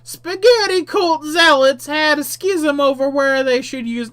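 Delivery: high, slow character voice; Manuel voice. Acted. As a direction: read it in a lofty storyteller voice. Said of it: "Manuel tells me".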